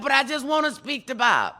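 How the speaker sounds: high, loud frustrated plea; retold outburst of exasperation; exasperated caller. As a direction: imitate a fed-up caller, raising the voice. Retold caller losing patience.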